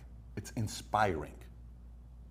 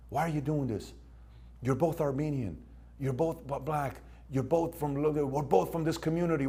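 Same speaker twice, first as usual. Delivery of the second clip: put-on higher, pleading older brother breaking up a fight; peacemaker big brother; 6 semitones higher than usual. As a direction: talk the kids down, urgent but caring. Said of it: "you're like".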